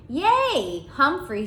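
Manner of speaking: high, loud delighted squeal; boy shrieks happily for Humphrey; shrieking with joy. Acted. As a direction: squeal with glee. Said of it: "Simon shrieked".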